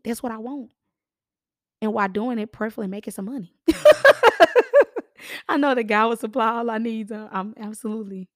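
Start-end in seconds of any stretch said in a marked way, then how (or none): 3.7-5.0 loud high burst of laughter; a big high cackle. Real, not acted. Host laughing at her own money joke, loud and high.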